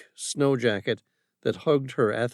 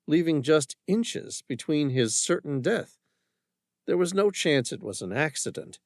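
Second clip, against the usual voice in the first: usual speaking voice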